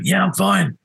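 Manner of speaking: high-pitched retold cover-up, falling at the end; pretending to be fine. Hungover drummer covering up to bandleader, high pretended composure. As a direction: act out an unconvincing brush-off. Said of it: "trying to pretend".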